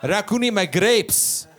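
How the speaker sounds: slow, accented dad impression; broken-English father explaining his grievance; dad's foreign accent. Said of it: "my dad can't speak good English".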